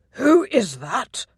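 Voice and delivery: a hint of the laird roaring a question; bellow. A narrator's reading. Laird Ross demanding who the drunk is, mild bellow. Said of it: "Ross bellowed".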